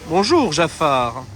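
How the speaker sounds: slow, drawn-out "Bonjour, Jafar"; greeting Jafar. Real, not acted. Meeting Jafar in the park: slow, drawn-out greeting. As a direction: stretch the greeting out.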